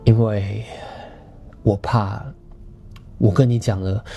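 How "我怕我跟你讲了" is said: ordinary delivery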